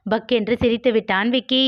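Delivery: usual speaking voice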